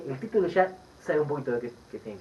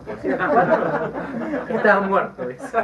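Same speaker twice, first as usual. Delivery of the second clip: loud, laughing punchline; slow, laughing mock complaint; 6 semitones higher, 8 dB louder, slower than usual. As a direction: laugh through the line as the battered thief. Beaten thief's punchline.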